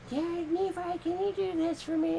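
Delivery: mock high-pitched wife voice; Cam. Friend mimicking a wife asking her husband, put-on high voice. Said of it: "Cam was all like".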